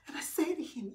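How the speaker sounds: normal talking voice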